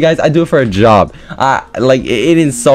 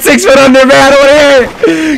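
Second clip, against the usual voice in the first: high, loud gloating rant building to a scream; taunting shouts; 13 semitones higher, 5 dB louder than usual. Pretend. Gamer trash-talking a downed player, gloating screams. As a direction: shriek insults over a beaten opponent.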